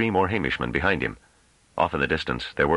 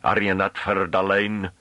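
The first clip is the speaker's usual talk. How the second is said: slow, higher rustic Hamish voice; thick Hamish accent; 5 semitones higher, slower than usual. A narrator's reading. Scholar goading the farmer, thick Hamish accent. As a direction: switch into broad, drawn-out Hamish. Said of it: "suddenly dropped into thick Hamish accent".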